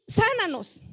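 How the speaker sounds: high, pleading call from afar; crying out. Retold or imitated. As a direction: call out to Jesus, pitched high. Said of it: "le gritaban en el camino".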